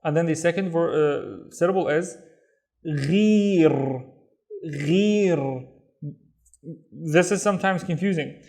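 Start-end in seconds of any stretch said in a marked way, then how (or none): 2.9-5.7 ghir with a gargled gh and rolled r; ghir, gargling the gh and rolling the r. Shown as a demonstration. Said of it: "the gargling sound".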